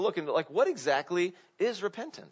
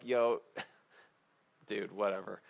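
dismissive shrug; 8 semitones lower, 6 dB quieter than usual. Retold or imitated. Saul brushing off Samuel.

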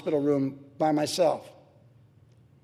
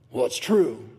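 lower, mildly sulky insistence; stubborn husband; 7 semitones lower, slower than usual. Pretend. Husband insisting to his wife over dinner, lower and stubborn. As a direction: dig in your heels lightly. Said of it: "conversation at the dinner table".

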